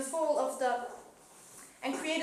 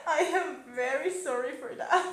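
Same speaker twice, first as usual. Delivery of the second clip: laughing apology; 2 semitones higher, 4 dB louder than usual. Real, not acted. Apologising while still laughing.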